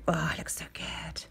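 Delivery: ordinary talk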